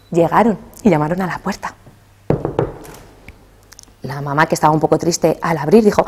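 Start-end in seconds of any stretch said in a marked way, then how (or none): none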